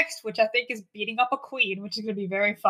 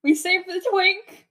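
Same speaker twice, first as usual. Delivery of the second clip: gleeful; 6 semitones higher, slower than usual. Real, not acted. Celebrating rescue.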